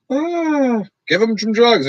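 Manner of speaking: mock careless parent brushing it off; a hint of a dismissive parent. Mimicking careless parents about their kids, lightly dismissive. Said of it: "kids that just sit there and go".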